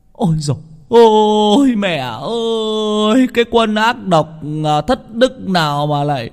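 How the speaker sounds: high, drawn-out wailing for a dead mother; grief-stricken howling, very high-pitched; wailing lament. Acted. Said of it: "đang gào khóc thảm thiết".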